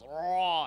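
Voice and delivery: "Raw" loud with a long rolled R; growled raw. Shown as a demonstration. Teacher showing how a lion growls, rolled "Raw". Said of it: "he growls".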